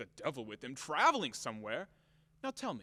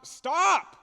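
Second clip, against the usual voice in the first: much higher and much louder shout; furious yell; 12 semitones higher, 12 dB louder than usual. Acted. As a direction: bellow it at full force. Yelling at coachman.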